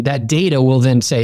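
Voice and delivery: normal talking voice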